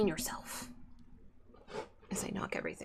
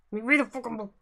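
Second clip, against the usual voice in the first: louder, exasperated scolding; 5 semitones higher, 10 dB louder, faster than usual. Real, not acted. Exasperated scolding of the cat for stopping his reading. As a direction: vent the frustration at the cat.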